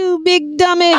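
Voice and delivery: squealing with laughter; laughing high mock scold. Real, not acted. Mocking, laughing.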